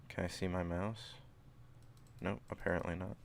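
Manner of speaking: ordinary delivery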